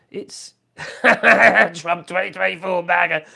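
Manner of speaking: slow, loud, laughing through it; mock the slogan while laughing; laughing mockery. Real, not acted. Mocking maga supporters.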